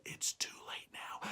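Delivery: secret whisper. Acted. Jack whispering back to his mom about going up again. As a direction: breathe it so mom barely hears.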